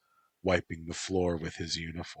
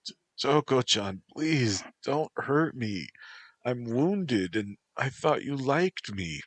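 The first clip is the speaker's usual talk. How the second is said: high, trembling plea; frightened pleading; 7 semitones higher, 3 dB louder than usual. Acted. Antropos begging Toko to stop, high frightened plea. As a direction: beg her not to hurt you. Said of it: "Antropos gasped".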